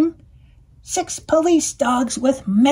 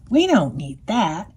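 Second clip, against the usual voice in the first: ordinary delivery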